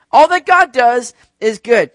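very loud, high, slow declaration; rousing proclamation. Real, not acted. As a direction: proclaim it at full force to rally a congregation. Repeats louder to rally the congregation.

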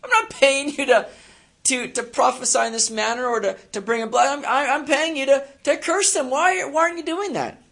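high, loud, exasperated king; king of Moab. Retold or imitated. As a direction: shriek it like a frantic king who paid for curses. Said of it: "the king of Moab was sitting there going".

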